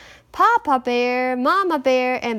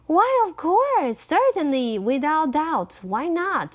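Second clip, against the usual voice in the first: eager agreement; 4 semitones higher than usual. Acted. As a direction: agree far too trustingly. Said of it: "said Henny Penny".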